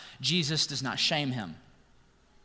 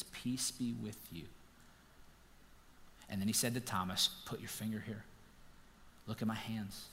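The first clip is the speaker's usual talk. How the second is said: gentle Jesus; 7 semitones lower, 15 dB quieter than usual. Retold or imitated. Risen Jesus greets his disciples, soft and gentle. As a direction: speak peace to frightened friends.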